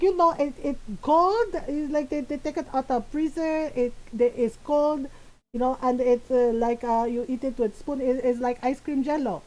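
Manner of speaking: imitated mom explaining earnestly in a Filipino accent; Filipino-accented, halting explanation; mom's Filipino accent. Mom describing the cold dessert, in her Filipino accent. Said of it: "in my mom's voice".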